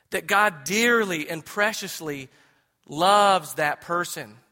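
each word leaned on slowly; slow down and weigh every word; deliberate, heavy emphasis; slow emphatic. Real, not acted. Slowing to stress God's love.

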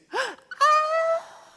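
sharp, high-pitched swooning gasp; girls' gasp. Retold or imitated. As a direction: gasp like a smitten schoolgirl. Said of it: "all the girls do this".

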